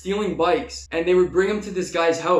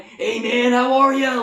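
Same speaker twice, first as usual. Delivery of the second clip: friendly stoner greeting; 7 semitones higher, 5 dB louder than usual. Retold or imitated. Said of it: "they would just be like".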